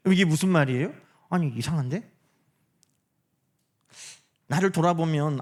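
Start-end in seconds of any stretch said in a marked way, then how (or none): none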